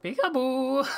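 high, loud sing-song peekaboo; spring it on him like a game; playful peekaboo. Real, not acted.

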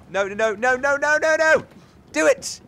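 high, loud burst of no's climbing to a yell; panicked shouting. Real, not acted. Panicking at a failed game move.

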